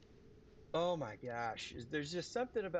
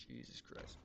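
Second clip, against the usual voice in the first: half-voiced thinking aloud; mumbling to self; 5 dB quieter than usual. Real, not acted. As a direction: mumble it absently to yourself. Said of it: "I just mumbling to myself".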